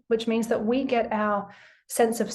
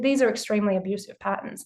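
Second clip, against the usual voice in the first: usual speaking voice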